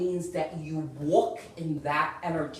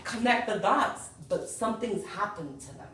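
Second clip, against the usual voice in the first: normal talking voice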